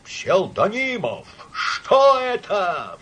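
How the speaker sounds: high, loud, long-drawn wail; slow, scandalised exclamation; appalled cry. A narrator's reading. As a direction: cry out for help, stretching the words. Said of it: "вскричал Иван Ильич".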